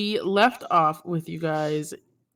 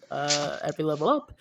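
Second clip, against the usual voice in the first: ordinary talk, nothing marked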